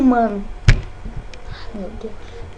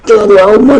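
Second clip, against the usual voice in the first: monster voice; 11 dB louder than usual. Pretend. Voz de monstro no jogo.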